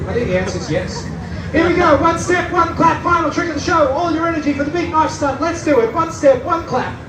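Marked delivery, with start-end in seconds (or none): 1.5-7.0 put-on overbright kids party host. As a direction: pump the crowd for the finale. Hyping final stunt.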